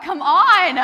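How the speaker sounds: loud, high call to the crowd; urging crowd. Real, not acted. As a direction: shout it out to rally the room. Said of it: "urging the crowd to cheer".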